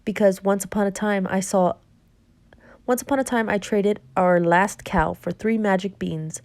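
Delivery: ordinary delivery, nothing marked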